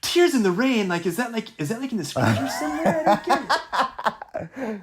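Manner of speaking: high, baffled voice; confused churchgoer. Retold or imitated. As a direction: sound puzzled by the quote. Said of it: "a handful of people in the congregation".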